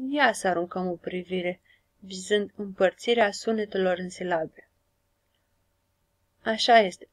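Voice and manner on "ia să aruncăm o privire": ordinary delivery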